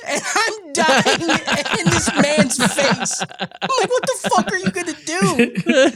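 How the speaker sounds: high, laughing storytelling voice; cracking up. Real, not acted. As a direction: squeal it through real laughter. Cracking up retelling the road-rage incident.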